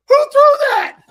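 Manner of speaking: high, loud indignant yell; hammed-up furious coach; mock angry coach. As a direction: bark it at the room.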